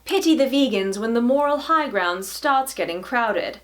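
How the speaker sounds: put-on upper-class British reading voice; posh British accent. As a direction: read it like a posh British columnist. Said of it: "my poshest British accent".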